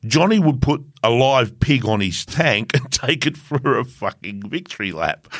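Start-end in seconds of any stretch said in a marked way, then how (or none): none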